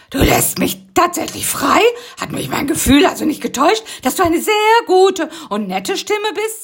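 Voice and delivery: high, loud relieved bug. Acted. Rocky relieved at being freed, high-voiced. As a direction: breathe out the relief. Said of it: "sagte Rocky erleichtert".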